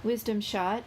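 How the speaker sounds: ordinary delivery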